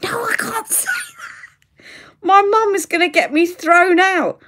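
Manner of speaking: high, wailing mock protest with laughter; panicked protest. Daughter fearing mum gets her thrown out, panicked protest. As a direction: squeal it in comic dread.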